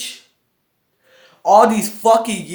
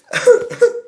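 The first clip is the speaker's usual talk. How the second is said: breathy high giggle; 6 dB louder than usual. Real, not acted. Laughs after squealing.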